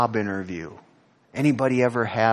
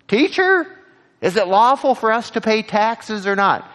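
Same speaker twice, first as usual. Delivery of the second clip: questioner voice; 9 semitones higher, 6 dB louder than usual. Acted. Voicing questioners testing Jesus.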